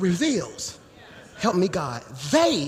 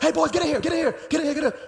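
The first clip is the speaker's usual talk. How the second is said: high, rapid-fire dad call; acted-out father summoning his sons; calling kids over; 6 semitones higher, much faster than usual. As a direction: round up the boys with repeated calls.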